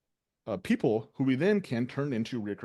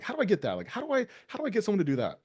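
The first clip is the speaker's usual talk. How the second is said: higher, pleading question; mock the eager owner asking how; imitating an asker; 8 semitones higher, faster than usual. Mimicking business owners asking how to get that. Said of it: "They're like".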